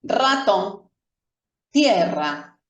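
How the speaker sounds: "Ratón" con r vibrante; "tierra" con rr marcada; r fuerte. Shown as a demonstration. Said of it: "es una R fuerte". (r vibrante en "Ratón")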